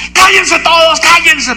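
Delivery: high, loud mock scolding; imitated angry order. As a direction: mimic an irritated man silencing a crowd. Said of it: "Pero estaba enojado".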